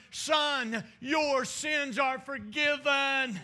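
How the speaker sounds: high, slow declaration of pardon; preached proclamation. Retold or imitated. Preacher as Jesus to the paralyzed man, preached proclamation. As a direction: proclaim each word to the whole room. Preached quote, then quietly repeated.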